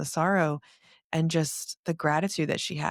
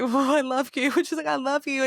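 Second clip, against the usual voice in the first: high-pitched, mock-weepy declaration of love; gushy, mock-tearful voice; retold tearful gushing; 9 semitones higher, 4 dB louder than usual. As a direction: imitate crying into a voice memo, pitched high. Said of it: "I'm crying into voice memos".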